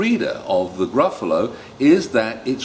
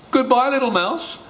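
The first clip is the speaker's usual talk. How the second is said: much higher hurried farewell; fleeing fox. Acted. The fox flees from the mouse, high hurried goodbye. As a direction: call it over your shoulder running. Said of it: "fox flees".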